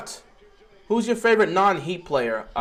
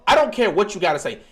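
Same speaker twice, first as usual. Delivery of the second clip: usual speaking voice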